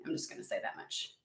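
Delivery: quick breathy whispered aside. Real, not acted. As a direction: drop to a quick whisper, just between us.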